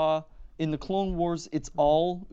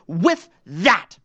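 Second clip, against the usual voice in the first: loud, punchy, triumphant emphasis; emphatic boast; 11 dB louder than usual. Real, not acted. Contestant boasts of winning a kids' trivia competition, emphatic. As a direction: land each word hard and loud.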